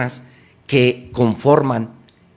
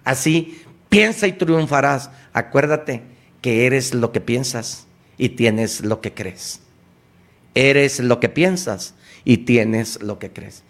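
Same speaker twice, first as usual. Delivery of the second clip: ordinary talk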